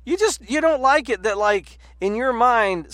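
usual speaking voice

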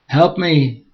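high, loud desperate scream; screaming for help. Acted. Woman hanging off a cliff screaming for help. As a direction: shriek it from the cliff face. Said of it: "she screamed".

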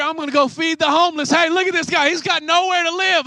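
loud, high sneering show-off; preacher mocking a showy do-gooder at full volume. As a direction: ham up a selfish do-gooder.

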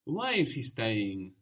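ordinary delivery

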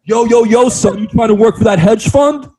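high, loud mock rapper; rich kid playing gangster. Ridiculing a Yale-bound rich kid, mock gangster. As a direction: hype it up like a wannabe rapper.

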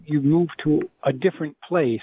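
ordinary delivery

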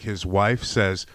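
ordinary talk, nothing marked

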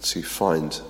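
guided pause before prayer; quiet reflection. Acted. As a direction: invite them gently into stillness.

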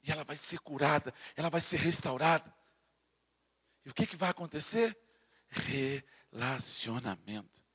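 softly marvelling, almost to yourself; hushed awe. Real, not acted. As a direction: drop way down, soft and low. Drops into hushed intimate tone.

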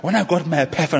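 mimicking. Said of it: "who speaks like that".

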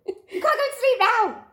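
high laughing yell, slowing; excited shout. Retold or imitated. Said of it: "and I was like".

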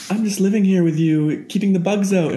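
high-pitched little spider voice; friendly meme spider. Acted. Spider from a meme to its human housemate, high innocent voice. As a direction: pipe up as a harmless housemate spider. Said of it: "where the spider's just like".

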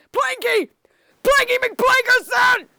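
high loud desperate call; shouting for help. Acted. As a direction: yell his name across the deck. Calling for help.

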